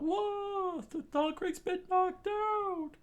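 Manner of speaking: very high, loud squeaky cry; pirates screaming. A narrator's reading. Pirates seeing their captain fall, high squeaky yell. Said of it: "the pirates all screamed out".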